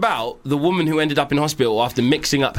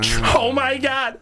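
excited; 10 semitones higher, slower than usual. Real, not acted. Excited exclamation.

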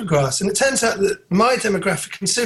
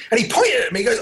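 ordinary talk

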